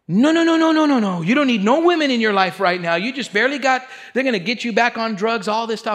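high, loud frantic no, no, no; mom's alarmed warning. Retold or imitated. Mother on the phone warning her son off women, alarmed and loud. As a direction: plead in a loud, high rush like a scared mother.

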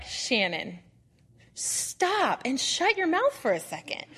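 a hint of a stern divine voice, slowed; "stop and shut your mouth" said slowly and firmly; stern Lord. The Lord stopping her in her tracks, slow and stern. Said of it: "this is how the Lord needs to speak to me".

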